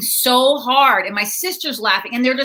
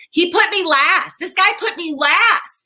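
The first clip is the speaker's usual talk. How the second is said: higher, drawn-out comic grievance; mock indignant; 7 semitones higher, slower than usual. Comic telling how she was scheduled at the end, indignant. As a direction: complain in disbelief, pitched up and slower. Indignant complaint about show running order.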